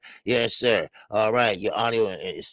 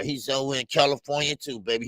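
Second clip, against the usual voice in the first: ordinary delivery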